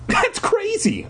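high-pitched protest; insistent. A narrator's reading. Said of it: "I insisted".

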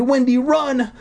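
high urgent shout to run; urgent warning. Acted. Deirdre urging Wendy to run, high and urgent. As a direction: warn her to flee now.

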